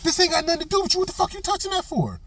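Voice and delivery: put-on high-pitched furious yell at the drunk; angry yell. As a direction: yell at him to leave the cones alone. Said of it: "I'm like".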